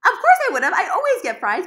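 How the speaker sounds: high, loud exasperation; indignant protest. Real, not acted. Defending herself over the French fries, indignant.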